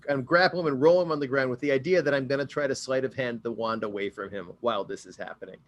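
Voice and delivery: normal talking voice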